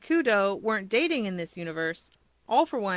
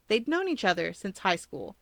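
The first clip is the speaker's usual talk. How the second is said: ordinary delivery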